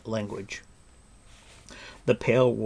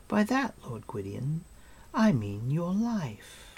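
higher, lilting, unhurried queenly voice; gently menacing queen; 5 semitones higher than usual. Acted. Queen offering her prisoner his life, gently condescending.

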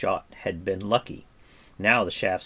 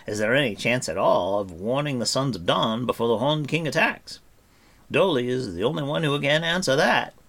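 higher, emphatic bard's voice; Fluter the bard, questioning; 4 semitones higher, 4 dB louder than usual. Acted. Bard asks whether warning is still possible, questioning. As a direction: pose the grave question with raised voice.

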